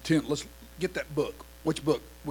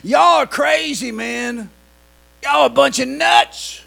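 jeering crowd on the wall, loud and sing-song; mocking onlookers; 9 semitones higher, 12 dB louder than usual. People on the walls jeer at Joshua's marchers, mocking loudly. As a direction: taunt the marchers from above. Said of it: "Everybody on top of them all said".

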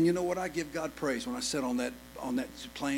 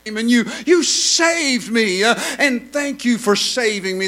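louder and higher, rising gospel preaching; full-force preaching; 8 semitones higher, 13 dB louder than usual. Acted. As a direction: preach it out with mounting fervor.

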